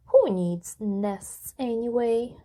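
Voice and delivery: ordinary delivery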